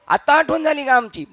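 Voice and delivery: high, pouting taunt; sulky reproach. Retold or imitated. Sulking devotee taunts God in a high, pouting voice. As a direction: tease like a sulking friend.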